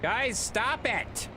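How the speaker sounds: high drawn-out whining yell; exasperated shout. Real, not acted. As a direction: drag it out in protest. Scolding chat.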